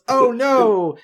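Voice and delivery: the whole class groaning at ruined photos; imitating students' loud dismay. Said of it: "and then everyone goes".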